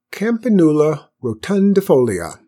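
important-sounding first announcement; inventive pronouncement. A narrator's reading. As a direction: try out a grand invented name, carefully. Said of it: "who was full of invention".

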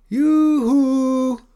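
very high, loud singsong call; female voice calling. A narrator's reading. Mother dragon hollers for her child, high calling voice. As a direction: call out for a child from far away. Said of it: "a female voice, sliced through the gathering gloom".

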